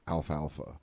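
Alfalfa said goofy and low. Shown as a demonstration. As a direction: drag out Alfalfa in a silly way. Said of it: "I say that goofy".

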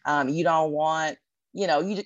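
ordinary talk, nothing marked

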